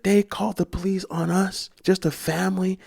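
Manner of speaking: high-pitched, indignant mom; imitated outrage. Mom furious that police were called, imitated outrage. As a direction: protest in a high, incredulous voice. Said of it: "She's furious".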